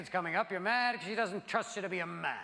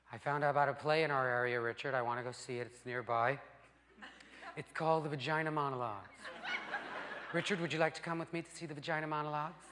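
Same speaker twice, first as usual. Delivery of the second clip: soft, fast, lightly voiced girlfriend; a hint of an eager girlfriend pleading; 7 semitones lower, 5 dB quieter, faster than usual. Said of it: "They come in going".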